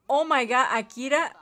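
normal talking voice

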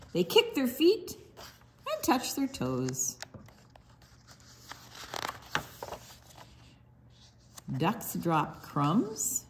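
sing-song picture book read; reading to children. Acted. Librarian reads duck book to children, sing-song. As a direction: bounce the rhymes for little listeners.

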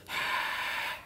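long voiceless "haaa" breath; open-throated fogging breath; breathy exhale. Shown as a demonstration. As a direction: breathe out as if fogging a screen to clean it. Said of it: "Imagine you wanna clean your screen".

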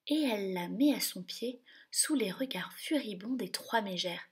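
ordinary delivery, nothing marked

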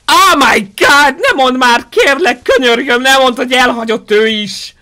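loud, high pleading, first words drawn out; dismayed outburst. Real, not acted. Streamer reacting to being abandoned in the game, loud dismayed pleading. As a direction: cry out in disbelief and beg.

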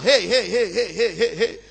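repeated "hey" bouncing back; a distant echo answering. A narrator's reading. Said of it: "the thing kept re-echoing back".

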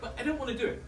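high, reluctant whine in play; scared pleading. Acted. Nervous jumper to the instructor, high reluctant pleading. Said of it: "I'm scared".

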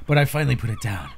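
ordinary talk

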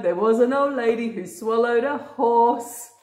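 usual speaking voice

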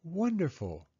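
Mama answering Penny softly and warmly; warm mother. Acted. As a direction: say it gently, glad for her. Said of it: "said Mama".

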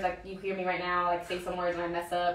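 ordinary talk, nothing marked

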